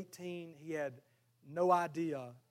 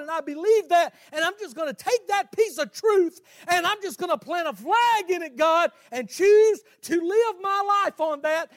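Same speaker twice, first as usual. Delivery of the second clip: high, very loud impassioned preaching; shouted sermon climax, driving each phrase home; full-force preaching; 14 semitones higher, 17 dB louder than usual. Real, not acted. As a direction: preach it at the top of your voice.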